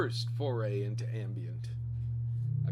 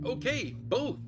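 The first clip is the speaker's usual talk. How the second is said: high excited exclamation; 11 semitones higher, 4 dB louder than usual. Retold or imitated. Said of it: "So I said".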